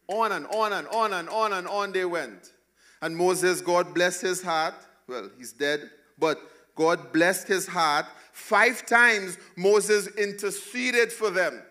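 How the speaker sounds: climbing, loud pulpit delivery; full-force preaching. Acted. Pastor rousing the congregation, preaching at full force.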